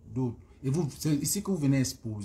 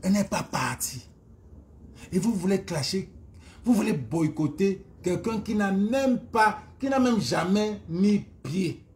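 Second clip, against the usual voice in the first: indignée; 5 semitones higher, 4 dB louder than usual. Real, not acted. Indignée qu'on boycotte Lolo.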